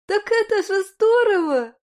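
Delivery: high, loud, delighted exclamation; thrilled, eyes wide. Acted. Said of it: "У Людмилы округлились глаза".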